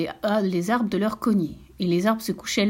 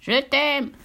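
higher, odd little croaky voice; frog call; 5 semitones higher than usual. Acted. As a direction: frogs calling to the stream. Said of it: "les grenouilles lui criaient".

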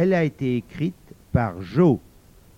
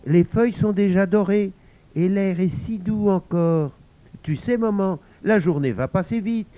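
high, quick, coaxing; cheerful child; 5 semitones higher, 3 dB louder than usual. Acted. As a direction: cheer mum up on the way. (Pauline enjouée qui distrait sa maman)

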